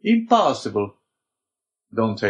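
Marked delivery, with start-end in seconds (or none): 0.0-1.0 high, emphatic refusal; crying out. A narrator's reading. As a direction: reject it with a sharp rise in pitch. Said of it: "cried the Abbé".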